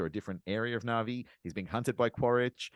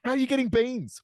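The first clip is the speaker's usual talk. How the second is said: high, louder comic outburst; incredulous demand; 13 semitones higher, 5 dB louder than usual. Pretend. Podcaster questioning the film's coffee supply, incredulous. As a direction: demand an answer in mock outrage.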